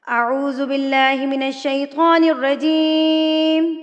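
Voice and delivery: slow, drawn-out chanted recitation; Quranic recitation. Shown as a demonstration. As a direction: recite with tajweed, long and measured. Tajweed recitation opening. (تلاوت کا انداز)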